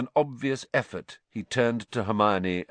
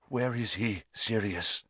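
shaking voice; 4 dB quieter than usual. A narrator's reading. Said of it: "a voice that shook with some suppressed emotion".

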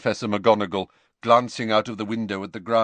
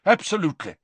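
Sprout; 10 semitones higher, 3 dB louder, much faster than usual. A narrator's reading. Sprout agrees.

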